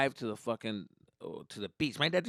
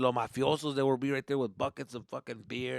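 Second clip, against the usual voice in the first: usual speaking voice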